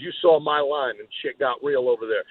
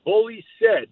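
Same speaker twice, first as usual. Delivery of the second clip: heated protest; 8 semitones higher, 5 dB louder, slower than usual. Real, not acted. Defending himself, raised voice.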